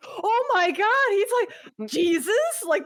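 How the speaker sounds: very high, slow gushing voice; squealing mock awe; awestruck crowd. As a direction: gasp it like an amazed fan. Crowd awed at Buggy.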